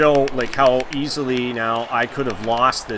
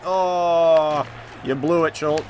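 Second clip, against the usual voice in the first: ordinary talk, nothing marked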